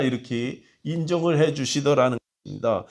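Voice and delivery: ordinary talk, nothing marked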